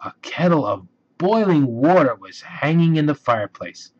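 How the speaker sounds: usual speaking voice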